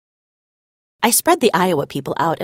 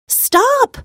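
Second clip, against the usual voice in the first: yell; 4 dB louder than usual. A narrator's reading. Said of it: "I yell".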